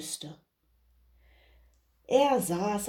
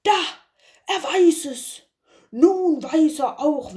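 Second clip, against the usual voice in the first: much higher and louder, an amazed lord calling out; excited call; 7 semitones higher, 6 dB louder than usual. Acted. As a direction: exclaim it in astonished delight. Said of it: "rief er". (der Herr ruft staunend und laut, viel höher)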